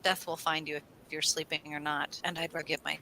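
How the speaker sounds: soft, low, calm reassurance; quiet, unbothered warrior wisdom; calm soft Viking. Retold or imitated. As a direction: settle him gently like a wise old friend. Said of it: "And he goes".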